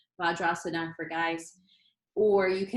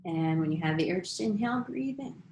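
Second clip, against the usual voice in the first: guided meditation. Acted. Leads breathing practice.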